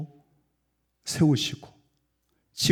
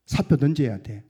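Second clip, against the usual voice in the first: ordinary talk, nothing marked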